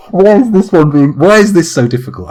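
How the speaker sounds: high-pitched exasperated complaint; exasperated outburst. Real, not acted. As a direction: burst out in comic frustration. Frustrated at failed attempts.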